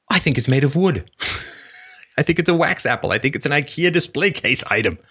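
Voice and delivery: high, disgusted customer turning down the apple; hammed-up fussy customer; fussy refusal. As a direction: play the impossibly picky buyer. Customer giving absurd reasons.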